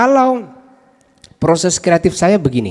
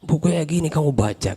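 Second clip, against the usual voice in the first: mock scornful mentor; 5 dB quieter than usual.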